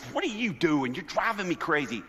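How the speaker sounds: an impatient dad losing it; exasperated parent. Retold or imitated. Impatient dad snapping at kids.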